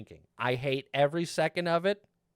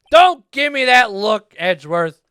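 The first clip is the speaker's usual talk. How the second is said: mock scolding; 7 semitones higher, 12 dB louder than usual. Scolding a game character.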